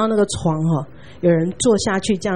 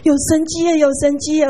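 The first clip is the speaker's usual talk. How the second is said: bursting with joyful news; excited; 8 semitones higher than usual. Retold or imitated. Elderly mother on the phone, excitedly telling of a miracle. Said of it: "她好兴奋地跟我说".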